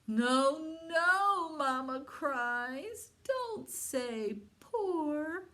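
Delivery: high, slow dismayed mama dragon; mama dragon crying out. Acted. Mother dragon begs her babies to roar right. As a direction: plead with the babies, stretched out.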